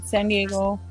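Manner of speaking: normal talking voice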